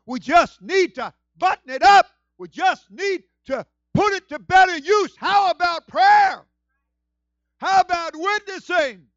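high, loud preaching cry; full-force preaching. Real, not acted. Preacher exhorting church family, full force.

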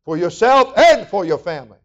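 loud, high-pitched full-force sermon; fiery preaching. Acted. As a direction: preach it hard to the congregation.

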